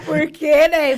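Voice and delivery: telling the story through big laughs; laughing loudly. Real, not acted. Laughing about childhood slipper beatings.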